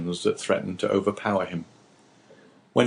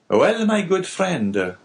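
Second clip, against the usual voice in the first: utter indifference; 10 semitones higher, 4 dB louder, much slower than usual. Acted. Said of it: "in a tone that indicated utter indifference".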